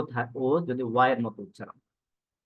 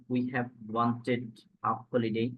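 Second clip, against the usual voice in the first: slow dictation; much slower than usual. Shown as a demonstration. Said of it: "teacher reads an example sentence".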